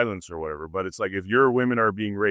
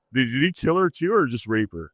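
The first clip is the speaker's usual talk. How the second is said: ordinary talk, nothing marked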